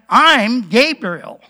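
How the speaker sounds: emphatic comeback; Gabriel. Acted. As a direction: hit the name hard as an answer back. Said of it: "answers back".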